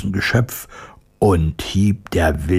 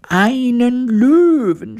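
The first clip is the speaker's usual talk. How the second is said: very high, drawn-out shriek; horrified cry; 3 dB louder, slower than usual. A narrator's reading. Mouse queen hearing of a lion, horrified shriek. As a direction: stretch it into a squealed cry of horror. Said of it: "schrie die Königin entsetzt".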